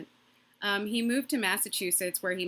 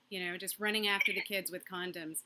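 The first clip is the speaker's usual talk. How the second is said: ordinary delivery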